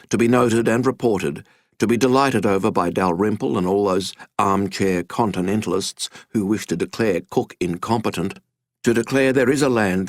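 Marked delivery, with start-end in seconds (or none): none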